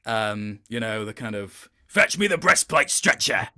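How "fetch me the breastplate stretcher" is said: higher, louder hearty bellow of a big bluff king; Robert Baratheon roar. Retold or imitated. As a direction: boom it out like a jovial king calling for his armour. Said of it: "Robert's roaring laugh".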